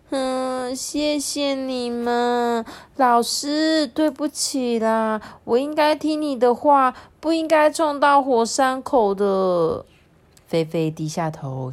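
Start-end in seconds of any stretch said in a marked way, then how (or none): 0.1-9.9 higher-pitched, drawn-out contrite whine; ashamed apology. Acted. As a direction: apologise sheepishly, stretching the words out. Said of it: "羞愧地说". (羞愧地拖长声音道歉)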